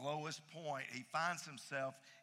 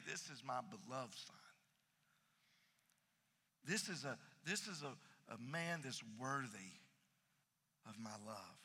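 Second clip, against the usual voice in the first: much softer, hushed loving words; tender father voice; 5 semitones lower, 8 dB quieter than usual. Acted. God softly affirming his beloved son.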